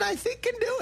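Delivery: sudden squeaky high pitch; high register voice. Acted. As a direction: jump way up into falsetto. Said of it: "what's your high register take".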